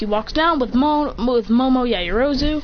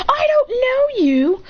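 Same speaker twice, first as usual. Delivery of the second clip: high, slow wailing protest; desperate denial; 13 semitones higher, slower than usual. Acted. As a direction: wail each word in comic distress. Protest at being called boyfriend.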